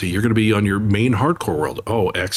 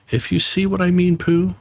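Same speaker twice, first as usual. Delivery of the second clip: higher lightly voiced Piglet; a small pig's friendly chatter, higher; 11 semitones higher, 3 dB louder than usual. Piglet speaking to Pooh.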